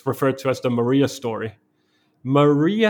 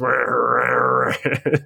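a voiced growl for a rumbling stomach; mock stomach growl. Telling how his stomach gave him away, mock stomach growl. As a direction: make your stomach growl with your voice. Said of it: "And my stomach goes".

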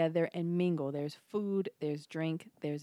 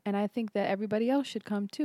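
normal talking voice

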